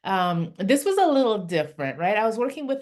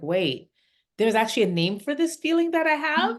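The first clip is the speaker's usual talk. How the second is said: lightly voiced child, pitch climbing into a question; child's realization; 6 semitones higher than usual. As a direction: act out a child's discovery, rising to the end. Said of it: "now Alex is like".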